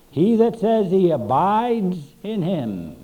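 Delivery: ordinary delivery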